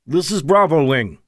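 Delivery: put-on loud radio call in a light Russian accent; Russian commander hailing over the radio, Russian accent; thick Russian accent. A narrator's reading. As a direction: announce yourself, Russian accent. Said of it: "a voice with a thick Russian accent".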